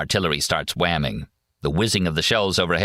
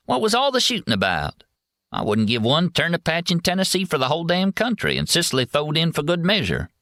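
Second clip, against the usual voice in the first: high, rough Southern soldier voice; Tennessee soldier voice; 12 semitones higher than usual. A narrator's reading. Snuffy speaks, Tennessee soldier.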